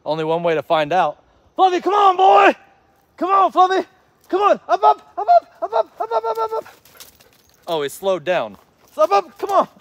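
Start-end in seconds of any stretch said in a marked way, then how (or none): 1.6-6.8 high, loud, eager calling to the dog; excited dog call. Real, not acted. Trainer calling his poodle to come, high and loud. 8.9-9.7 high, loud coaxing call; excited urging. Real, not acted. Trainer urging his dog to jump, high and eager.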